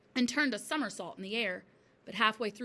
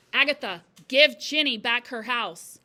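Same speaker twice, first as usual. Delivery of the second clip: ghostly command; 2 semitones higher, 11 dB louder than usual. A narrator's reading. Ghostly chalk gives command.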